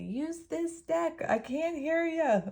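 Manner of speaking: high, slow, squeaky little voice from beyond; spirit guide voice. Retold or imitated. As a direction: play a tiny spirit calling from far away. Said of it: "I feel like somebody's telling me".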